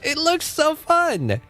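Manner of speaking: high, squealing enthusiasm; can't contain how hyped she is; gushing excitement. Real, not acted. Answering chat about the DK game, squealing with excitement.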